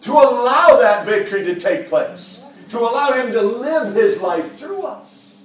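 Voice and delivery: loud, high, fervent exhortation; full-force preaching. Acted. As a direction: preach it out with fire.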